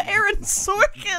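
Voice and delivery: high unsure guess. Real, not acted.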